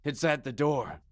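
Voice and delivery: scared, telling what he feels; uneasy prisoner. Acted. Prisoner below reporting the knocking, afraid.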